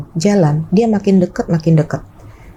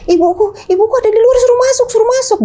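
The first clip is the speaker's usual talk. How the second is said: put-on much higher, fast, urgent old man; imitating confused father; faster than usual. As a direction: rush it out high like a confused father.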